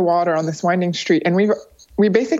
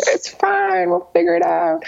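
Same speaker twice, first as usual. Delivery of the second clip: breezy unconcern; 11 semitones higher than usual. Retold or imitated. Dismissing worry about no place.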